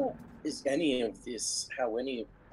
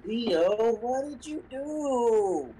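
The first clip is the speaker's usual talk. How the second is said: higher, louder, slow accusation; dramatic accusing yell; 10 semitones higher, 5 dB louder, much slower than usual. Acted. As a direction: wail the question, slowly. Peak of escalation.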